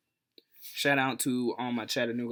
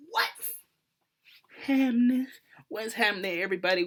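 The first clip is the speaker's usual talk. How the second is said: much higher, loud what's happening; hyped greeting; 10 semitones higher, 5 dB louder than usual. Acted. Host hyping his YouTube family, high and loud. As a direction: greet the fans like a show host.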